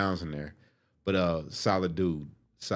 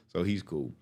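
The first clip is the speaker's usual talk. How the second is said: ordinary delivery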